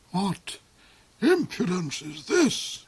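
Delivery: high indignant cry; cried out. Acted. The Duke cries out as a ball bounces down the stairs. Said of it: "he cried".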